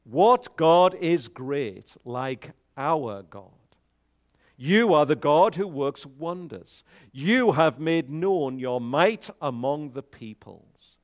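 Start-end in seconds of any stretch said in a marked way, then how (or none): none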